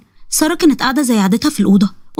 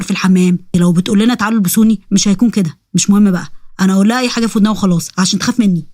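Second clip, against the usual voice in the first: low-pitched, grumbling ifrit voice; scheming ifrit; 5 semitones lower than usual. Acted. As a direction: drop the pitch and plot to scare the girl.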